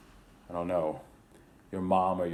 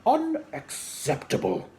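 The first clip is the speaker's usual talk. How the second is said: stern bear; 8 semitones higher, 4 dB louder, slower than usual. Acted. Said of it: "says Mister Bear".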